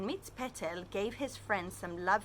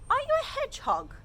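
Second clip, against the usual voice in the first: squeaky guess; 5 dB louder, faster than usual. Acted.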